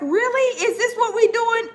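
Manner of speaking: high-pitched incredulous questioning; mock astonishment; retold disbelief. Retelling her disbelief at the outbursts.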